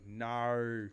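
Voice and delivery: "No" stretched into an Australian "naur"; Australian pronunciation. Shown as a demonstration. Teaching a co-host, "No" with Australian r-coloured vowel. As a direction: say "No" as a drawn-out "naur". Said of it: "Just go".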